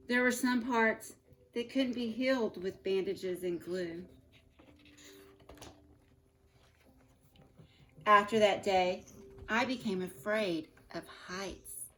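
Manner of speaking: put-on reading a picture book aloud to children; storybook reading. A narrator's reading. Teacher reading Humpty Dumpty story to her class, storybook voice. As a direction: tell the story gently to your class.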